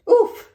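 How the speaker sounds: high, loud startled oof; loud high oof as she sinks in. A narrator's reading. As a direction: sink in with a surprised grunt. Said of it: "she mumbled".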